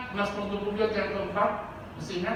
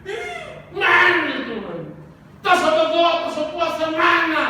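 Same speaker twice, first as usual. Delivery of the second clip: high, loud acted complaint against God; mock grumbling; 8 semitones higher, 8 dB louder than usual. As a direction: shout it like an exasperated complainer, exaggerated. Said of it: "Berhenti bersungut-sungut". (tiruan bersungut-sungut dengan suara keras)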